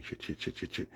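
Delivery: whispered frantic mock panic. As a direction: hiss it fast like someone who just got caught. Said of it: "Everybody was panicking".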